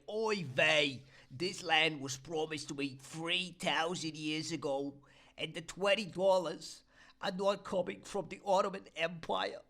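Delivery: high, hammed-up Yiddish accent. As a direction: kvetch it in a thick Yiddish accent. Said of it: "and you just say".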